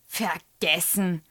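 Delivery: slow, drawn-out annoyed echo. A narrator's reading. Teacher repeats the pupil's excuse, annoyed. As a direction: throw the word back slowly, irritated. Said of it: "sagt Frau Schröder ärgerlich".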